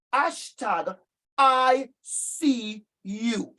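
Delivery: each word drawn out slowly, x0.4 pace; a slogan spelled out slowly for teachers to remember; slow deliberate slogan. Real, not acted. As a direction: lay down "hashtag I see you" word by word, slowly.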